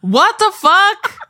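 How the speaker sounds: very high, loud, slow incredulous squeal; shrieked disbelief. Real, not acted. Friend reacting to the actor's hometown, shrieking disbelief. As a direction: stretch it out in total shock. Outraged he's from Anaheim.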